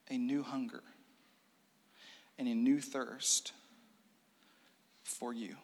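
soft, hushed prayer. Acted. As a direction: pray it quietly to the Spirit. Prayer in sermon.

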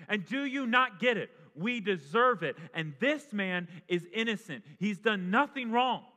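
one dying criminal rebuking the other, loud and sharp. Acted. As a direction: scold him from the next cross. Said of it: "the other says".

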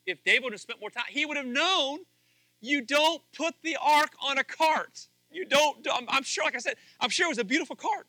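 preaching at full force. Acted. Pastor warning his church about David's cart, preaching at full force. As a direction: pastor raising his voice to hammer the lesson.

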